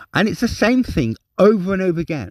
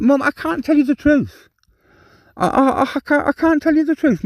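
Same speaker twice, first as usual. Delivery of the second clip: higher-pitched retold protest to mum; exasperated pleading; 7 semitones higher than usual.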